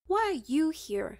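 loud, high sharp retort; shot back. Acted. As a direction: fire the question back at him. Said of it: "she shot back".

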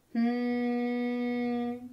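a steady humming drone; fridge hum. Acted. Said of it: "went the refrigerator".

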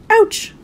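sharp high yelp of pain; sudden little cry of hurt; pained ouch. A narrator's reading. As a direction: yelp as you hit the wall. Jumps out of bed, hits wall.

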